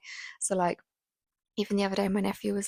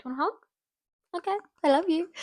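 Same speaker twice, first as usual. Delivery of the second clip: sweet, gentle; 10 semitones higher, 4 dB louder, faster than usual. Retold or imitated. Mum gently offering a hug.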